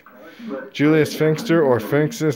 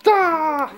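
sudden startled ah; a quick high cry of surprise; sharp high yelp; 14 semitones higher than usual. Real, not acted. Alone with the camera, a startled yelp.

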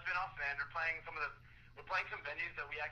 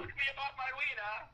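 much higher, hammed-up repeat; silly high voice; 12 semitones higher, 4 dB louder than usual. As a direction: pitch it way up for a joke. Repeats request in silly put-on voice.

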